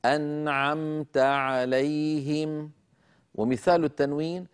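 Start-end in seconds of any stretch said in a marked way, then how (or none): 0.0-2.7 slow melodic recitation, "أنعمت" going straight from noon into ayn; clear izhar. Shown as a demonstration. Teacher demonstrating noon before ayn in "أنعمت", slow chanted izhar. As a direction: chant "أنعمت عليهم" with no extra hum on the noon. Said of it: "أن أزيد في زمن الغنة".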